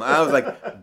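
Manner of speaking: usual speaking voice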